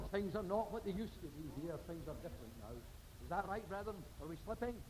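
a hushed, searching question to the flock; hushed appeal. Acted. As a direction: drop to a near hush, pleading with them. Intimate appeal to congregation.

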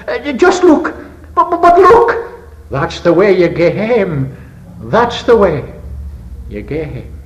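put-on high, trembling Scots boy's voice; tremulous child. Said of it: "his voice tremulous with emotions".

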